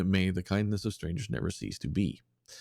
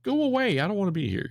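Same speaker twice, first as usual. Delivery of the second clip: very high, loud panicky yeti cries; freaked-out yeti; 3 dB louder than usual. Retold or imitated. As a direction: yeti wailing to be anywhere else. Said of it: "that's the Yeti's immediate reaction".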